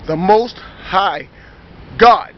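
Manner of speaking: very slow, weighty delivery, GOD punched loud; solemn declaration. Retold or imitated. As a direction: draw out each word, then land hard on God. Quoting a solemn declaration of faith.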